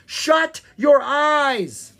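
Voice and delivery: slow, loud, high command; authoritative shout. A narrator's reading. Bard commands the prince near the monster, shouting with authority. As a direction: shout each word as an urgent order. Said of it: "Will spoke with such authority".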